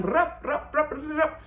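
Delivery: rapid rup rup. Pretend. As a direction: tick it off like a fast-forward noise. Showing a show that rushes along.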